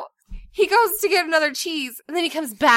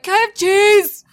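loud, higher mock kid voice; child's demand; 8 semitones higher, 10 dB louder than usual. Son asks mom through the bedroom door, mock loud kid demand. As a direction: imitate a kid calling out loudly, high.

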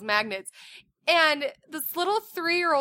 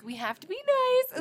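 higher sing-song parent voice; diplomatic parent; 13 semitones higher than usual. Retold or imitated. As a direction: sweetly coax the kids to be nice. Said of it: "diplomatic parents, like".

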